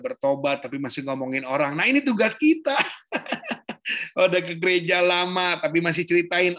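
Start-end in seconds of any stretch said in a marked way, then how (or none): 2.8-4.1 high-pitched real laugh. Real, not acted. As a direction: burst of high chuckles after your own remark. Laughs at own remark about gossipers.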